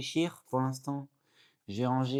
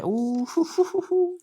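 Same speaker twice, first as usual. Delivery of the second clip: excited hooting; 6 dB louder than usual. Real, not acted. Pulls The Killer card, hooting.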